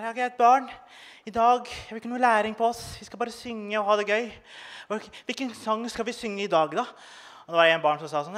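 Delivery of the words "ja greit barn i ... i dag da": a hint of a dreamy teacher; hippie teacher voice. Substitute teacher cheerfully greets the class, lightly mocking.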